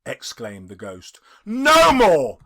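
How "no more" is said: loud anguished cry; cried out. A narrator's reading. Scrooge crying out against the ghost, loud and pained. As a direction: cry out in protest. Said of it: "cried Scrooge".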